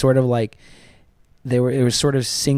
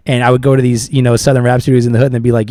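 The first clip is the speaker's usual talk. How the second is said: ordinary talk, nothing marked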